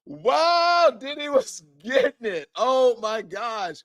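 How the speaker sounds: high, laughing disbelief; host still laughing at the seized items; giggly wow. Real, not acted. Host cracking up at list.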